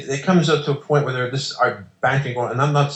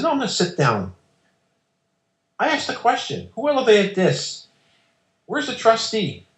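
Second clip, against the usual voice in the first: higher-pitched indignant retort; defiant challenge; 10 semitones higher than usual. Retold or imitated. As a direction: raise the voice up and demand answers. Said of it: "I get really defiant".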